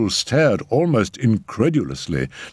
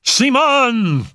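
very high, louder call; desperate cry; 5 dB louder than usual. A narrator's reading. Freed Raoul calls the medium, desperate cry. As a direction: cry the name out, pitch soaring. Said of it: "cried Raoul".

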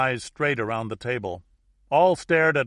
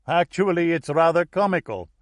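higher, louder cardinal's aside; cardinal from Rome; 6 semitones higher, 7 dB louder than usual. A narrator's reading. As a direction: pipe up as the Roman cardinal. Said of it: "said a man from Rome".

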